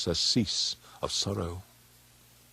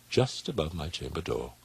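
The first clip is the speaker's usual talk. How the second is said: usual speaking voice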